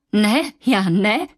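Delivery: slow, halting stammer. Acted. Anna denies the theft, slow stammering. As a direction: choke out the denial.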